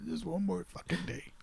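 very soft, almost under the breath; hushed anticipation. Real, not acted. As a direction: say it quietly, as if to yourself. Counting down to film release.

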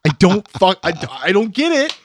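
baffled outburst. Real, not acted. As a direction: burst out in disbelief. Baffled rant about own pet peeves.

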